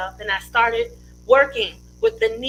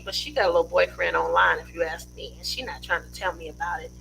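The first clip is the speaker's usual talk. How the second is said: gossipy aside; 7 semitones lower, 6 dB quieter, faster than usual. Real, not acted. Gossiping about mom's boyfriend.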